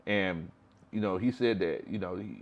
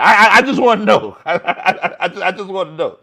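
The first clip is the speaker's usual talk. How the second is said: laughing; 8 semitones higher, 11 dB louder than usual. Real, not acted. Laughing while asking.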